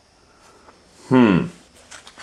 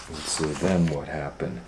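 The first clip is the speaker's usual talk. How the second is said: plain reading voice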